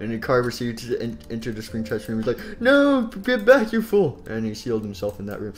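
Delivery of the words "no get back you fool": high, loud, drawn-out warning; alarmed villain. Acted. Springtrap ordering car away, high alarmed shout. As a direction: cry out to drive the intruder back.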